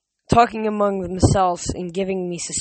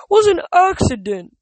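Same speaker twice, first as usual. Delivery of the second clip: protesting; 12 semitones higher, 7 dB louder than usual. Acted. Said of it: "Tyson protested".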